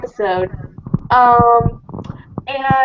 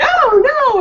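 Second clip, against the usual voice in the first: mock panicked chicken; 11 semitones higher, 5 dB louder than usual. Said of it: "And then Chicken's like".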